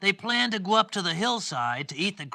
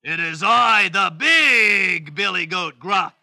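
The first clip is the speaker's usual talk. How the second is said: loud goat voice; 8 dB louder than usual. Acted. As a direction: announce yourself loudly as the biggest goat. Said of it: "in a loud voice".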